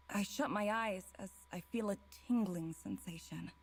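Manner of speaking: normal talking voice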